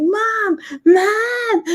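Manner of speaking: put-on high, crying shout of a lost child; son's frightened wail for mom. Son running and crying for mom.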